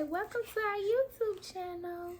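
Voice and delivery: put-on high, soft little baby voice; coaxing baby voice; baby's voice. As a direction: speak for the baby in a tiny high voice.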